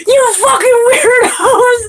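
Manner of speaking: high, slow, drawn-out mock yell; yelling it out the car window as he drives off. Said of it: "And he was like".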